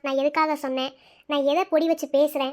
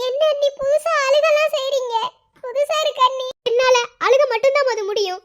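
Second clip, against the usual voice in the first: higher, teary voice fighting back sobs; a laugh breaking through tears; choked up tearful; 7 semitones higher, 4 dB louder than usual. Acted. Sister-in-law fighting back tears with a wet laugh. Teary exchange about crying.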